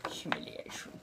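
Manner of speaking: put-on a lightly held eighteenth-century colonial American accent; a mild colonial American accent, chatty and theatrical. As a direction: keep the old colonial American lilt going as you chat. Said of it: "the accent is, uh, roughly seventeen hundreds colonial American".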